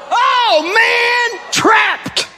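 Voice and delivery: high shouted cry of defeat; exclaiming trapped. Real, not acted. Preacher caught by God's word, shouting in mock defeat. Realizes he is cornered.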